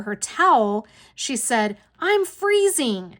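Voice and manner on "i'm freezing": high, drawn-out mock whine; imitating a cold, indignant aunt; retold complaint. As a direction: stretch out the complaint, pitched way up. Aunt complains after cold shower.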